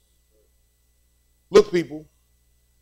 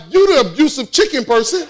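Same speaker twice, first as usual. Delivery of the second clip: mock accusation; 7 semitones higher than usual. Mock accusation back at the prince.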